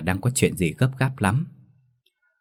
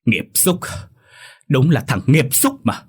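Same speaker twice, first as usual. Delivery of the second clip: very high, loud enraged yell; furious shout; 5 semitones higher, 3 dB louder than usual. A narrator's reading. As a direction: curse the son in rage. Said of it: "quát lớn".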